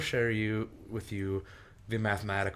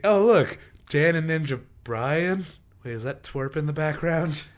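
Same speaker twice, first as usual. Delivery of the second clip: high, loud mock excitement; raised, exclaiming voice; acted surprised spotting; 8 semitones higher, 8 dB louder than usual. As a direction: call it out like a viewer spotting someone on TV. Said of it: "It's like, it's like".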